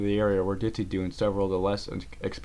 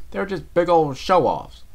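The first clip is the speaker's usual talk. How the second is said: higher, louder, slow scoff; scornful; 9 semitones higher, 8 dB louder, slower than usual. Acted. Applejack scoffs at the Pegasus ponies. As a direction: dismiss them as braggarts.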